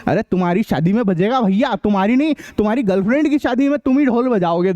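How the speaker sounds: ordinary talk, nothing marked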